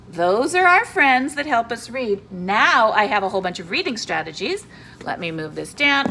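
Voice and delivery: usual speaking voice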